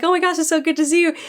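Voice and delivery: fast, high, gushing reunion; mock excited greeting. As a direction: rush it out, thrilled to see a friend. Mat greets Perrin excitedly.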